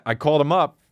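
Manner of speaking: ordinary talk, nothing marked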